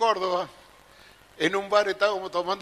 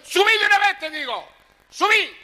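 much higher, loud scolding wife voice; screaming at him; 12 semitones higher, 6 dB louder than usual. Retold or imitated. Wife screams at husband to come up, high loud yell. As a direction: shriek the order up from the balcony. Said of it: "Le gritaba a la vieja".

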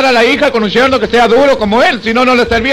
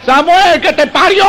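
mocking shout; 6 semitones higher, slower than usual. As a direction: fling the insult across the lines. Sentry's mocking shout at night.

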